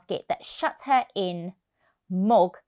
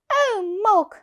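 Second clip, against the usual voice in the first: put-on child's voice; 11 semitones higher, 5 dB louder than usual. Child dismayed.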